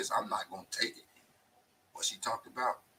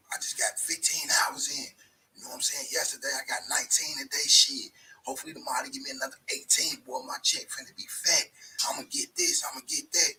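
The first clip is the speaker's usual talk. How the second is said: hyped-up worker; 5 semitones higher, 6 dB louder than usual. Retold or imitated.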